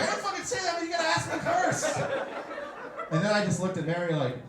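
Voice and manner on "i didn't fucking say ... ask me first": high, shocked squeak; a much higher mock outraged voice; high-pitched quote. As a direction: squeal it out in disbelief. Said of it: "I was like".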